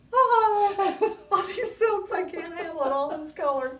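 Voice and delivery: high, loud overjoyed squeal; gushing excitement. Retold or imitated. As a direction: squeal over the new silks. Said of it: "and I just went".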